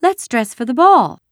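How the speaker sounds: high, loud doll voice; play-acting girl. A narrator's reading. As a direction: propose it grandly in a make-believe game.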